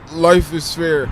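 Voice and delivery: Russian accent, thick vowels. Shown as a demonstration. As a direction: say it like a Russian guy. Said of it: "In Russian accent".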